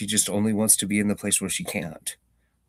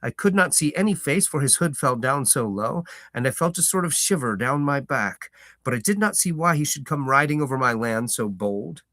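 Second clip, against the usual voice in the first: lively audiobook narration, slightly raised; storyteller reading a novel aloud; story narration; 8 semitones higher, 4 dB louder than usual. A narrator's reading. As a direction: tell it like a fireside tale.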